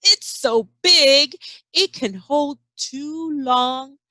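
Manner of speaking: slow, drawn-out read-aloud; slow picture-book narration; storybook reading. A narrator's reading. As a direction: read it big and slow for a child.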